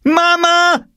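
put-on piercingly high, loud girl's scream; drawn-out outraged shriek. A narrator's reading. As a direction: yell for your mother. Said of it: "schrie Jule".

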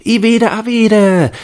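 put-on high, loud, slow child's shout; children shouting. A narrator's reading. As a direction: yell the title like a child. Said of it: "schrien einige".

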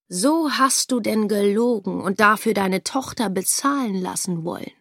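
plain reading voice

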